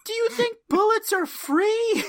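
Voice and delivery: high, loud indignant question; hammed-up exasperated yell; mock outrage. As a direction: scold them at the top of your pitch. Mirror Genie berating the A-Team.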